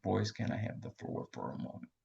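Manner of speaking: soft whispered plea to the two men; whispered request. Retold or imitated. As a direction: lean in and whisper it. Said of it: "leaned over in between them and whispered".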